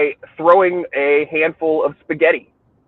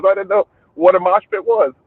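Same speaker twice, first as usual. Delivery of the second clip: usual speaking voice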